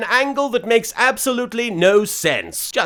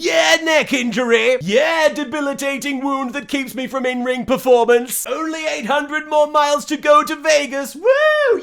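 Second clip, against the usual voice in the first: put-on drunk voice; 5 semitones higher than usual. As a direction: whoop it up like a drunk road-tripper. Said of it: "She sounds like a drunk character".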